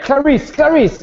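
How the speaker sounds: high urgent call. Acted. Rashid calling to Clarice, high urgent shout. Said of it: "he's like".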